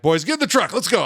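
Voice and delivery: high-pitched mock-urgent dad order; alarmed parent. Dad retelling herding his boys from the edge, mock alarm. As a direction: act out hurrying the kids away.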